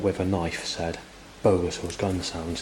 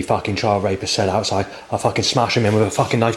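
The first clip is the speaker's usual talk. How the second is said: loud, higher, raging threats; furious yelling; 4 semitones higher, 10 dB louder than usual. Retold or imitated. Relaying the outside voice's threats, furious yelling. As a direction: spit the threats out in a fury.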